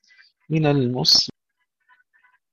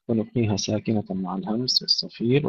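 ordinary delivery

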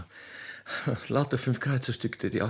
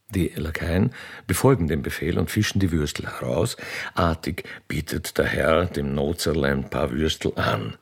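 stage directions read; 10 semitones lower than usual. A narrator's reading. Narrator reads out the arrival of the royal train, stage voice. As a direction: announce the scene like a stage narrator.

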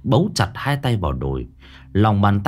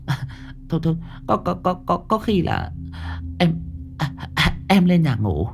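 high, stammering and rushed, repeating words; flustered, stumbling excuse to flee the room; hurried frightened excuse; 8 semitones higher than usual. Acted. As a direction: blurt it out in a panic to get away. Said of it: "một câu nói vội vàng".

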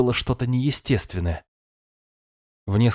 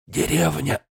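breathless, forced out; gasping for air; 5 semitones higher, slower than usual. Acted. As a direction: squeeze the word out between gasps. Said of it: "задыхаясь, выдавил мужчина". (задыхаясь, с трудом выдавливая)

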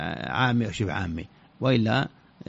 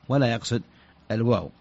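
usual speaking voice

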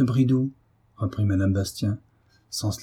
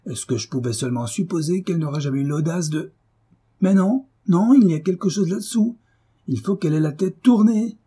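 outrage mounting with suspicion; flaring fury; 6 semitones higher than usual. A narrator's reading. Husband suspecting his wife behind her back, flaring fury. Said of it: "dont la fureur s'exalta de nouveau".

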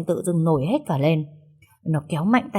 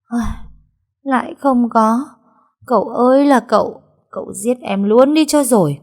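exasperated despair; 5 semitones higher, 5 dB louder than usual. A narrator's reading. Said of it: "nó buông thõng tay thở dài".

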